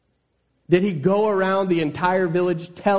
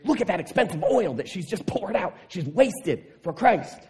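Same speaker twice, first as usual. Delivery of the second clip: miffed disciples; 4 semitones higher than usual. Retold or imitated. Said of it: "They're upset. They're angry".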